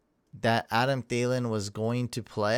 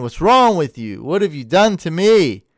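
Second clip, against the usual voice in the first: mock exasperation; 5 semitones higher, 9 dB louder than usual. Mock despair at listener's question.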